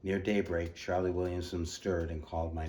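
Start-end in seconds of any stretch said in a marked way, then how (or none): none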